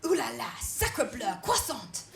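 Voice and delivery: lightly mock French accent; hammed-up French, ooh la la. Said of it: "They're like".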